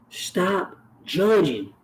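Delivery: each word slow and heavy; slow emphatic demand. Real, not acted. Speaking to judgmental listeners, a slow firm demand. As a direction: lay down the rule slowly, word by word. Demanding people stop judging.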